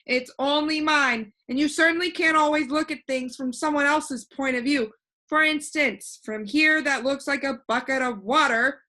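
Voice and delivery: put-on a cheerful know-it-all boy floating in the air; voiced boy Alec. Alec explains viewpoint.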